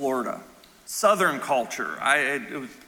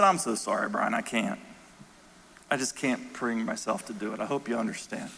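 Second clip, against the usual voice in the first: low, hushed and apologetic; soft apology; 6 semitones lower, 6 dB quieter than usual. Retold or imitated. Widow declining her pastor's invitation, in a low soft voice. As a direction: retell her regretful refusal softly.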